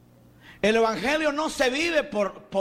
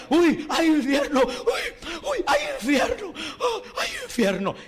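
much higher, mock-frightened wailing of uy and hay infierno; mock terror; 8 semitones higher than usual. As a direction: play the scared believer, hammed-up panic. Mocking a fearful believer in the pew.

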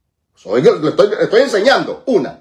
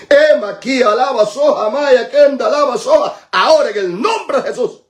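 from drawn-out chant to ringing command; fervent preaching in tongues; 5 semitones higher than usual. Real, not acted. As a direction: preach into tongues, climbing to a shouted invocation.

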